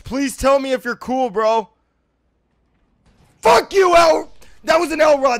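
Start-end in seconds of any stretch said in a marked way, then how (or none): 3.4-5.4 high, loud cursing; angry shout. Real, not acted. Player cursing whoever that was, angry shout. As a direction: yell it hotly at a rival.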